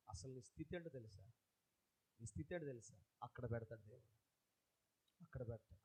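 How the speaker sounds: hushed preacher speaking heart to heart; soft intimate preaching. Acted. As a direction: draw them in with a hushed, personal plea.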